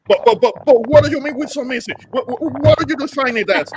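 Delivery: high, loud indignant challenge; mocking indignant. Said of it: "she got triggered".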